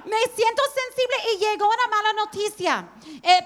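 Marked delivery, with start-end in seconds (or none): 0.1-1.2 higher, fast mocking whine; quick mock whine. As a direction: rattle it off, teasing yourself. Tercer día, riéndose. (quejido burlón y rápido)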